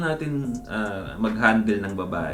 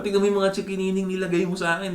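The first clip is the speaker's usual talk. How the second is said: quick, higher teasing retort; teasing guy; 7 semitones higher, faster than usual. Retold or imitated. Guy teasing the woman about the marks, fast and higher. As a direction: toss it back at her fast and higher. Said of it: "For example".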